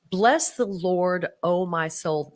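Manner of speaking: measured devotional reading; scripture reading. Acted. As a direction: read the psalm with reverence. Reads scripture aloud.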